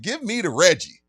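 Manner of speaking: loud, high insistent demand. Retold or imitated. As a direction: bark it like an order.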